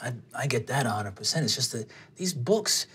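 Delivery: put-on low, soft older guy's voice; offhand sage in a low register; shrugging older man. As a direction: dispense wisdom low and quiet, with a shrug. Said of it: "he shrugs and goes".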